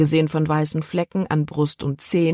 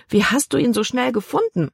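put-on girl Libby; 7 semitones higher than usual. A narrator's reading. Libby questions father.

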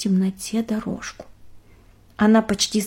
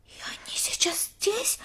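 whisper; 11 semitones higher, 9 dB quieter than usual. Acted. Nick eager whisper.

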